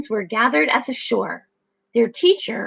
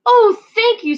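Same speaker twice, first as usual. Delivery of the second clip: delighted thanks; 11 semitones higher, 8 dB louder than usual. Acted. Pip delighted.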